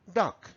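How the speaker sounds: duck said high and short, ending in k; DUCK, short vowel. Shown as a demonstration. Teacher contrasting duck with dog, high and short. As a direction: pitch duck up and cut it short. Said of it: "oís la diferencia".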